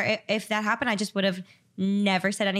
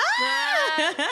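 high shrieking laugh. Real, not acted. Friend shrieks at confession.

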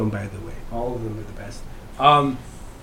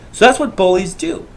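ordinary talk, nothing marked

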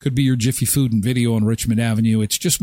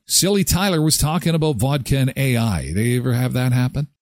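usual speaking voice

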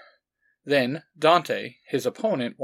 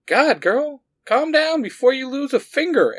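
put-on much higher teasing girl voice; chuckling girl; 12 semitones higher, 5 dB louder than usual. A narrator's reading. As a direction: tease her friend with a laugh. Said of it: "Angelique chuckled".

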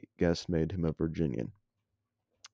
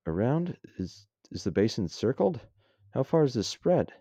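higher, halting questions with rising pitch; confused; 6 semitones higher than usual. A narrator's reading. Soldier questioning the general about the base, confused. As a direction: puzzle over it, growing a little alarmed. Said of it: "Sly was confused by the word".

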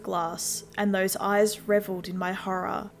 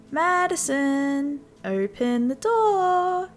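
slow, lilting, much higher creepy sing-song; sing-songy; 6 semitones higher, much slower than usual. A narrator's reading. Creepy neighbour luring the girl to open the door, sing-song. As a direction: coax her to open the door in a creepy singsong. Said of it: "he said in a sing-songy voice".

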